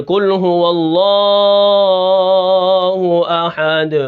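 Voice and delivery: Allah in "قل هو اللہ احد" held far too long; over-lengthened letters. Shown as a demonstration. Teacher shows students the fault of adding letters, over-lengthened recitation. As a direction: stretch the vowels of "اللہ" out for seconds. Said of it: "کہیں حرف بڑھا دے".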